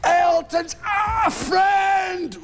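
high, very slow shouted announcement; drawn-out announcer shout. Retold or imitated. Announcer calling to the crowd, hammed-up shout. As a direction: stretch it out like an arena MC. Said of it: "he would say".

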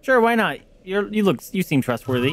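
high, loud mock agreement; sarcastic. Real, not acted. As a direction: fake enthusiasm, dripping sarcasm. Mock agreement with creepy god.

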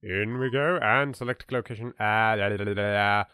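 usual speaking voice

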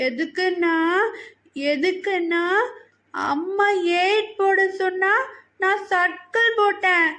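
put-on high, drawn-out little-girl voice; little child. A narrator's reading. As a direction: explain to daddy like a small, upset child. Little girl explains to father.

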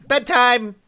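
high, loud singsong call; shouted bedtime. Pretend. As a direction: call it out at full volume. Announcing bedtime loudly.

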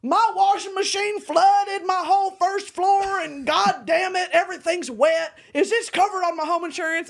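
high, loud Texan caller yelling about a flood; mock outraged Texas customer; angry caller. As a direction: rant like a furious homeowner. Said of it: "they'd call me and be like".

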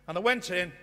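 ordinary delivery

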